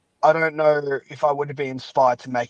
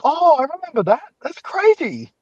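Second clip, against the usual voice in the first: high, louder burst of sudden recognition; excited realization; 5 dB louder than usual. Retold or imitated. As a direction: exclaim it like a light bulb going on. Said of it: "a lot of people will be like".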